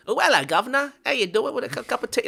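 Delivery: high, loud mock Cockney; Cockney accent. Shown as a demonstration. Speaker demonstrating Australia's accent roots, hammed-up Cockney. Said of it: "the Cockney accent".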